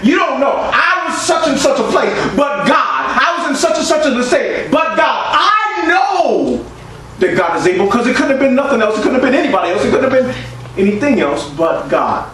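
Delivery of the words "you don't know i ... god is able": high, shouted testimony with attitude. Shown as a demonstration. Believer testifying to doubters, shouted with attitude. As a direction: declare it like you lived it. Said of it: "say it with some attitude".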